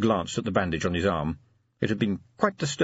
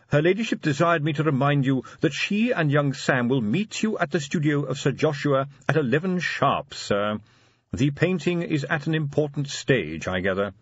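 higher, precise, deferential voice; butler relaying orders; 7 semitones higher than usual. A narrator's reading. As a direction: pass on the lady's reminder formally.

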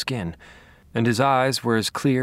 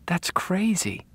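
whispering; 8 semitones higher, 3 dB quieter, slower than usual. Acted. Deb disbelieving in hospital.

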